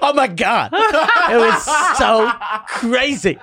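high, slow camp gushing voice; camp Joe Pesci. Pretend. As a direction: gush like an out-of-character star, laughing. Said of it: "And he goes".

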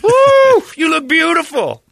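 high, loud woo then a gushing compliment; excited whoop. Acted. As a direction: whoop and gush like a fan. Acted clerk whooping compliment.

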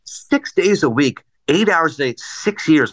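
slow, pointed recount; incredulous tallying. Retold or imitated. Listener doing the math on a star's boast, slowly and pointedly. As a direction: spell out each figure slowly in mock disbelief.

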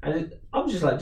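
ordinary talk

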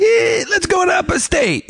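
very high, drawn-out holler; hammed-up immigrant grandpa. Grandpa off the boat urging everyone onward, hollered. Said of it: "my grandpa".

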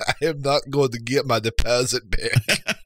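jokey Icelandic tourist complaining; mock Icelandic accent. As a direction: grumble about the deposit in a Nordic accent. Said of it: "good impressions".

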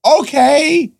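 very high, deflated okay; mock resigned okay. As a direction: squeak out a let-down okay. Retold resigned reply.

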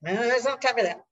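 quick, lifted voice for the dolphin; dolphin's voice. Retold or imitated. Speaker voicing the dolphin's nudge to stay present, quick and lifted. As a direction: voice the dolphin telling her to stop overthinking.

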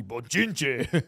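macho tough-guy delivery; manly. Shown as a demonstration. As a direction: say it like a big tough man. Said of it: "So say it manly".

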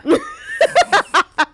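loud squealing laughter; helpless cackle; high shrieking laugh. Real, not acted. Laughing at the joke.

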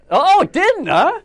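high, loud incredulous yelp; can't believe he didn't like it; surprised disbelief. Real, not acted. Disbelief at teammate's movie take.

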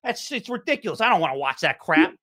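high-pitched griping Philly sports fan; Philly accent. Acted. Fan griping about the Rockets' threes and defense, Philly accent. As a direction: rant in a thick Philly accent. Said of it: "Philly, Philly accent says".

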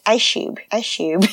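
ICE CUBE said as "eye-shoob"; toddler mispronunciation. Retold or imitated. Mom showing her kid's word, ICE CUBE said "eye-shoob". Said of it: "says ice cube like this".

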